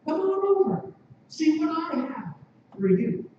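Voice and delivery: high, coaxing come-hither voice; sing-song seductress, lightly playful; seductive temptress. Acted. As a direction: lure them in sweetly, pitched well up. Seductress Folly beckons passersby.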